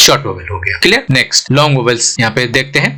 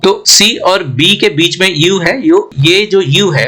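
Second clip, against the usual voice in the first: slow explaining; 3 semitones higher, slower than usual. Shown as a demonstration. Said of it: "लंबा खींच रहा है".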